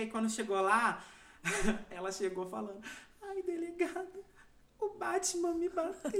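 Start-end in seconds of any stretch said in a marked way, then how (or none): 3.2-6.1 high, soft, slow whimpering plea; mock crying complaint. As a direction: play a fake sobbing victim to the police chief. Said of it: "Ela chorou".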